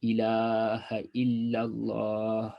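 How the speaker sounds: ilaha illallah drawn out slowly; slow dhikr delivery; elongated dhikr. Shown as a demonstration. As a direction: stretch illallah long and smooth. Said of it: "go straight up to the".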